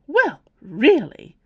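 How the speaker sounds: offended professor huffing at the claims; huffy. A narrator's reading. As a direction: puff up in offended indignation. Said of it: "huffed Professor Tunbridge".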